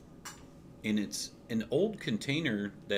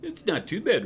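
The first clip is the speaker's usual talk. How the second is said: mild Travolta impression; 10 semitones higher, 5 dB louder than usual. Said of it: "John Travolta imitation there".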